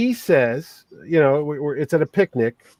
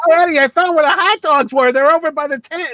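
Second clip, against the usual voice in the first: very high nails-on-chalkboard voice; hammed-up grating imitation of a wife; Lorraine Bracco; 10 semitones higher, 3 dB louder than usual. Wife telling her husband where the hot dogs are, piercingly high. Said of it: "the nails on the chalkboard".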